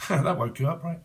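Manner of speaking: ordinary talk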